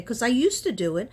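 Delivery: ordinary talk, nothing marked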